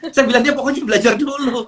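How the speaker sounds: amused chuckle. Real, not acted. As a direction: say it through a laugh. Chuckling over reply to child.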